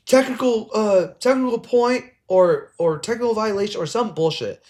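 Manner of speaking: high, mock-official ref voice. Coach mocks the ref calling a technical on him.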